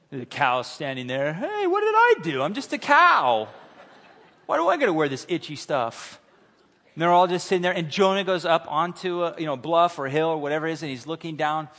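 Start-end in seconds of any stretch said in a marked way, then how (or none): 1.3-6.2 high, loud comic cow whining. Retold or imitated. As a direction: play the put-upon cow, squeaky and loud. Cow voiced in sermon joke.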